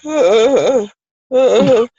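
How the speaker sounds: high, halting stammering sounds; dazed girl. Retold or imitated. Mimicking the dazed little girl, high and halting. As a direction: imitate a stunned little girl. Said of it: "she's like".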